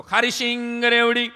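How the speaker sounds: hawker's call, high, loud and drawn out; loud vendor call. Retold or imitated. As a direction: bellow it like a street vendor. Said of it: "જોરથી બોલ્યો".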